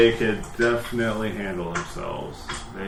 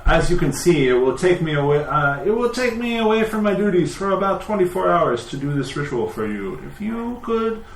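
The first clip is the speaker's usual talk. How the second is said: higher-pitched priest voice, reasoning politely; explaining temple priest; 8 semitones higher than usual. Acted. Priest explains his fee to the party, polite and higher-pitched. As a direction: justify the fee in a lifted voice.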